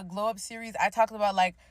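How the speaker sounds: normal talking voice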